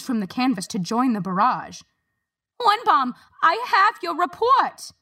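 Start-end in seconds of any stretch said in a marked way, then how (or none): 2.6-4.9 high, loud, urgent call; loud high shout to the painter; shouting over noise. A narrator's reading. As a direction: yell to be heard over the din. Harriet calls over the din.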